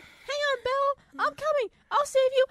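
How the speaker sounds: a hammed-up rescue call; squeaky Otto. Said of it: "He's like".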